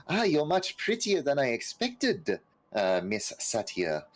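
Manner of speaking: high, flattering courtly stranger; flattering NPC voice. Acted. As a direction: greet her with smooth flattery. GM voices NPC greeting Satya.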